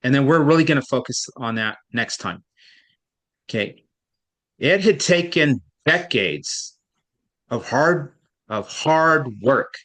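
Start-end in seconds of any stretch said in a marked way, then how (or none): 4.6-9.7 decades punched, slow and deliberate; stress on decades. Shown as a demonstration. Teacher models the sentence for a student, stress on "decades". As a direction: read it slowly, leaning hard on "decades". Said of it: "So I emphasize decades".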